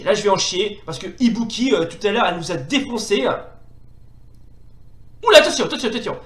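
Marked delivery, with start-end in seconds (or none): 5.2-6.2 loud, high quick yelp; startled high watch-out; alarmed warning. Real, not acted. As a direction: warn yourself as the attack comes. Warning during fight.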